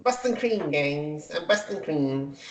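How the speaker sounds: soft mock catchphrase, starting high; Donut. Host mimics Donut signing off to cook, soft teasing imitation. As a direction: repeat it as a quiet, teasing imitation. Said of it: "he's just like".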